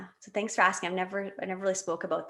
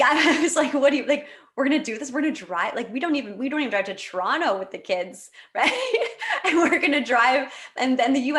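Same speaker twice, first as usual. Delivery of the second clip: laughing, louder and higher, in disbelief; 5 semitones higher, 6 dB louder than usual. Retold or imitated. Telling her husband the drive is crazy, laughing.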